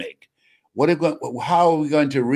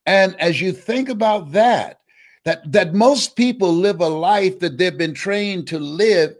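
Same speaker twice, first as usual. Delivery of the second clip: rising sermon; 4 semitones higher than usual. Acted. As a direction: lift them up like a preacher. Preaches to the audience.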